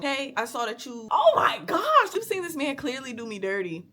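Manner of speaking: squealing oh my gosh in the middle; imitating a friend gasping at the news; mock excited friend. Retelling the friend's shocked gasp over the man. Friend quoted in retold chat.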